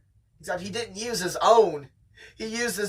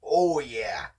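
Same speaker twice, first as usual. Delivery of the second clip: ordinary talk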